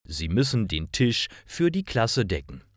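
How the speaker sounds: ordinary talk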